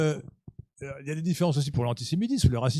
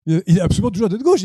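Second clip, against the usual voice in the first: emphatic insistence; 4 semitones higher, 10 dB louder, faster than usual. Real, not acted. Insisting against Frédéric Lemoine.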